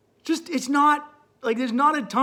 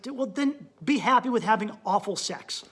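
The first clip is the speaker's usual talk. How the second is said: ordinary talk, nothing marked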